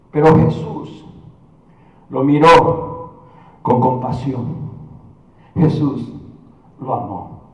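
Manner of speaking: low, hushed, spaced-out phrases; slow, reverent lowering of the voice; tender compassion. Real, not acted. Tender turn on Jesus' compassion.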